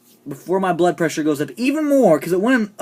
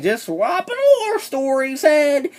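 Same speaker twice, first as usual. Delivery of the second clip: high, slow put-on character voice; drawn-out high-pitched mimicry; mock Mandria voice; 10 semitones higher, slower than usual. Reviewer imitating Mandria confronting Ed, high and drawn out.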